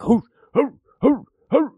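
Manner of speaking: high hooting to scare off a bear; shooing hoots. Retold or imitated. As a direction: hoot high and sharp to shoo them. Staff shooing robbers like a bear.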